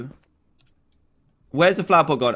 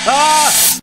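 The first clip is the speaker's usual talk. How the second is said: high sharp scream; startled scream; 13 semitones higher, 5 dB louder than usual. Real, not acted. Gamer shrieking at the jump scare.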